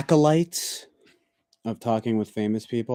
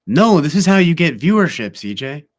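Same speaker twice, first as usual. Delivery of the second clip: emphatic retort; 5 semitones higher, 9 dB louder than usual. Retold or imitated. Reads out a viewer's retort.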